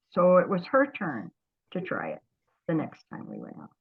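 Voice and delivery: normal talking voice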